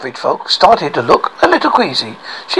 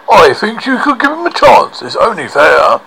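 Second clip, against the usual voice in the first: woodcutter voice; 4 semitones higher than usual. Acted.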